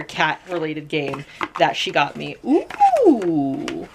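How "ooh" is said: long high "ooh" of delight. Real, not acted. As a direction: draw out a high, impressed ooh. Reacts to a new game.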